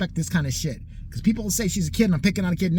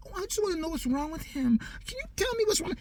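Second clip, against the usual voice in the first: higher, softer fake-innocent girl voice; mock girlfriend; 11 semitones higher, 7 dB quieter than usual. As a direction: play the sweetly prying girlfriend. Mimics girlfriend calling him.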